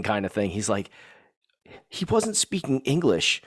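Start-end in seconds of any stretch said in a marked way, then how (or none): 1.9-3.4 lightly imitated kid, higher and slow; a small child's slow, higher-pitched verdict, retold; quoted young son. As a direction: say it as a kid reporting a finding. Voices his son's puzzled verdict.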